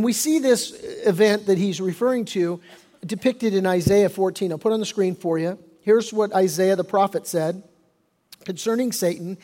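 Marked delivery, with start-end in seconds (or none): none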